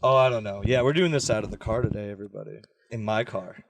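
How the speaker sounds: usual speaking voice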